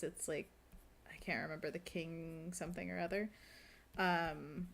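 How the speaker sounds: ordinary talk, nothing marked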